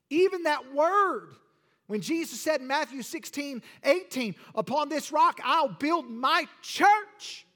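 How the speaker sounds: rising revival preacher; fervent preaching. Acted. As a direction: preach it out to the congregation. Preaching at full force.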